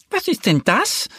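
high-pitched indignant duck; scolding little duck. A narrator's reading. Duck bumps into something hard, scolding. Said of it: "schimpft die kleine Ente".

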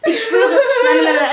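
high-pitched, giggly insistence; laughing protest. Real, not acted. Defending a film pick to teasing friends, laughing protest.

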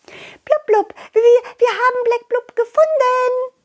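high, breathless fish announcing the find; tiny fish bursting up with good news; excited little fish. Acted.

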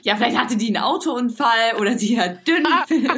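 giggling through mock examples; teasing with a laugh. Real, not acted. Laughing while mocking.

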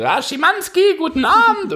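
high silly fake caller voice; prank caller voice. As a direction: put on a goofy high phone voice. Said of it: "dann haben wir halt immer irgendwie so".

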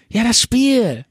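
high chirpy mock buddy voice; old friend nudging him to play; high eager buddy. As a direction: toss it off brightly.

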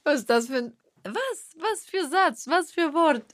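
high, put-on baffled voice, going up; mock confusion. Husband teasing his wife over a strange word, mock baffled.

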